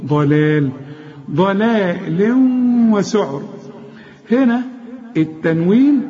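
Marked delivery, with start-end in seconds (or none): 0.1-0.7 drawn-out ضلال, light like دلال but with ض; heavy ض, then thin lams in ضلال; light lam after ض. Shown as a demonstration. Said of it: "زي دلال بس دي بالضاد". 1.3-3.4 slow ضلال وسعر, nasal hum into the w; ghunna merge. Shown as a demonstration. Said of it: "هنا في غنة".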